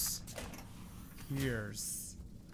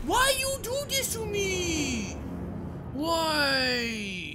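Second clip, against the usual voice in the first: high, whiny wailing complaint; real exasperated whine; mock wail; 11 semitones higher, much slower than usual. Real, not acted. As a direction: plead with the game like it betrayed you. Wailing at the game.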